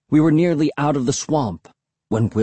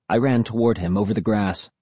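plain reading voice

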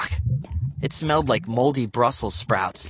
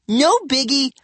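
high, loud huffy no biggie; sulky; 5 dB louder than usual. A narrator's reading. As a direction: toss off no biggie with a sulk. Sulky kid.

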